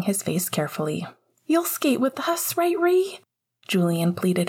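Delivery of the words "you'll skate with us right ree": put-on high, hopeful kid voice; pleading little boy. A narrator's reading. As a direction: coax big brother like a small boy. Child pleads with brother.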